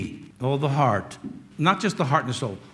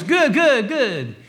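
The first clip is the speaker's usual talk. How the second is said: high, loud, over-bright stock reply; mock the automatic everything's-fine answer; hammed-up social pleasantry, easing down at the end; imitating polite protocol; 7 semitones higher, 3 dB louder, much slower than usual. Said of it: "people talk to you like that".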